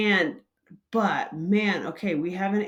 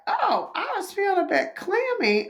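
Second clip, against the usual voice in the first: higher, lightly voiced elderly lady; older lady voice; 13 semitones higher, 3 dB louder than usual. Barista quoting the regular waking up, higher old-lady voice. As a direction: imitate the dazed old woman. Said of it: "she's like".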